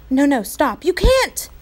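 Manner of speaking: high, loud urgent cry; urgent shout. A narrator's reading. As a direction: cry out to stop him. Omri stopping Patrick.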